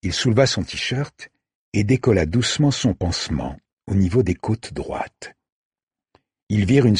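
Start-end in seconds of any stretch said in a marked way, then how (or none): none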